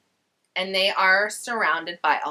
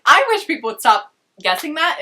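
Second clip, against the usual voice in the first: higher and louder, a put-on whiny complaint; mock annoyed; 6 semitones higher, 7 dB louder than usual. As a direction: whine it like a sulky teen. [name] complains about being predictable.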